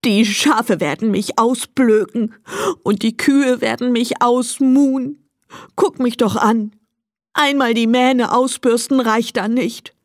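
tearful donkey's complaint; lamenting. A narrator's reading. Donkey Emil fears the farm animals' mockery, lamenting. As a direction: whine on in mild despair. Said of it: "klagte er weiter".

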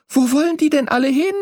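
high, quick excited question; calling out. A narrator's reading. Young bird asks parents about passing flock, calling out. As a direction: call it out high and fast. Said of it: "rief ich".

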